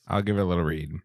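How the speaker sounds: ordinary talk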